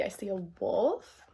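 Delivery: usual speaking voice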